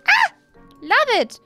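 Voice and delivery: ordinary talk, nothing marked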